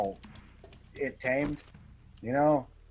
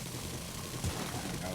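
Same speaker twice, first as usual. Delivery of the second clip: slow whispered mumble; whispered aside; 6 semitones lower, 10 dB quieter, much slower than usual. Real, not acted. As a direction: drop to a breathy whisper.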